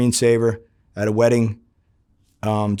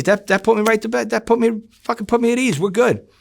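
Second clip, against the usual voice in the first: much higher, pitched-up relief; relieved, excited; 11 semitones higher than usual. Retold or imitated. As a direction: act thrilled to be put at ease. Relieved reply to the mob boss.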